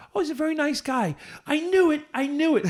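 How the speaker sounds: vindicated glee; excited. Retold or imitated. Retold answer and reaction.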